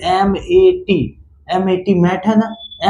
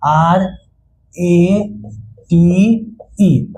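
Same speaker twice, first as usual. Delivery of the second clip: spelling letter by letter; much slower than usual. Shown as a demonstration. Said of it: "अब थोड़ा सा समझते हैं".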